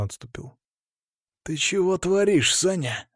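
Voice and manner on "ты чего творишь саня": higher-pitched tense reproach, not shouted; restrained angry hiss at a fighter; aggressive hiss. A narrator's reading. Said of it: "агрессивно зашипел Сергей".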